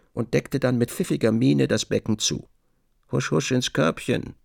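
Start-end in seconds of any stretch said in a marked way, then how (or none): none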